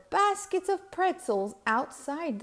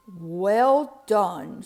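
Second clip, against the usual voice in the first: duke voice; 3 semitones lower, 7 dB louder than usual. A narrator's reading. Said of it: "said the Duke".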